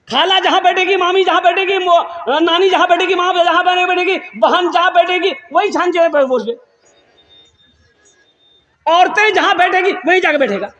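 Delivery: high, teasing sing-song mockery; mocking. Preacher mocking the young man who sits among women, high teasing. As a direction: ridicule him in a high voice.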